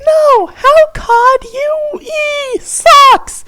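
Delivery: very high crying wail, slow; despairing screech. Acted. Fairy wailing over her crushed grubs, despairing screech. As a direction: shriek in heartbroken despair. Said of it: "screeched the fairy despairingly".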